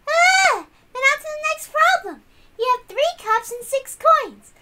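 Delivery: put-on high, bright-pitched, loud know-it-all girl; Erica's voice.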